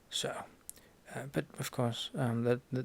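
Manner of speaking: ordinary talk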